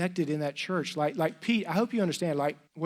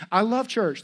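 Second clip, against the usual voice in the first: put-on loud, higher, thrilled teen; mock gushing; 4 semitones higher, 6 dB louder, slower than usual. Freshman raving about church pizza night, excited. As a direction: gush like a delighted freshman.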